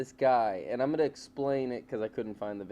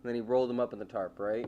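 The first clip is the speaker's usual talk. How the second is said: ordinary delivery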